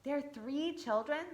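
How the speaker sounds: usual speaking voice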